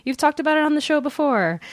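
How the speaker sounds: lightly voiced eager fan; mock listener letter. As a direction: play an excited fan writing in. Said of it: "write to us being like".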